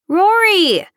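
high, loud drawn-out call; yelling over music. A narrator's reading. Taylor yelling for her friend over loud music, yelling. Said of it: "Taylor yelled over the loud music".

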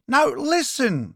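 high, drawn-out insistence; interrupting. A narrator's reading. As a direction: cut in urgently, stretching the words. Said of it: "she interrupted".

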